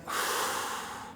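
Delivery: soft breathy sigh letting air out; long release exhale. Real, not acted. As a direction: let the held breath go. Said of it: "and then on an exhale".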